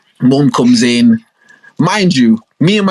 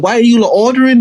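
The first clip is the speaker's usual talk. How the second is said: mum asking; 9 semitones higher, faster than usual. Retold or imitated. Mum pressing the kids to order. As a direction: ask like a nagging mum. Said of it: "she's like".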